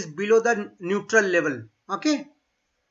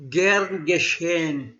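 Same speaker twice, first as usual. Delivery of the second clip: slow, careful Gern geschehen; teacher models it for repetition; deliberate pronunciation demo; slow model phrase; 3 semitones lower, slower than usual. Shown as a demonstration.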